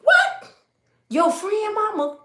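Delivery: high, startled disbelief with a laugh; a child stunned at mama's plan; shocked child. Retold or imitated. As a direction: squeak it out in disbelief.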